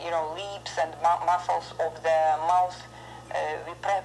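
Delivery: normal talking voice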